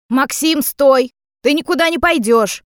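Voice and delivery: high, loud, angry order; commanding shout. A narrator's reading. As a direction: shout to stop him leaving. Mother shouts to stop son.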